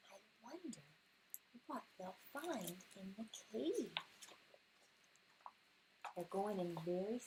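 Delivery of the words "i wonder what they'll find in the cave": hushed storybook whisper. Acted. Mother reading to her son, whispering into the cave. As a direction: whisper it to build suspense.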